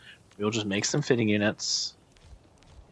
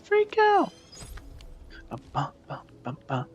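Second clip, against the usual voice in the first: squeaky, very high sing-song panic; hammed-up cartoon panic, squealing the words; mock freak out; 14 semitones higher than usual. Streamer hams up a freak out over a broken factory, very high mock squeal.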